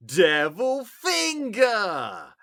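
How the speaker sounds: slow, drawn-out high shout of Devil Finger; shouted catchphrase. Retold or imitated. Kid in a sketch yelling his catchphrase, slow high shout. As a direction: bellow it like a possessed kid. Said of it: "he would always say it with that inflection".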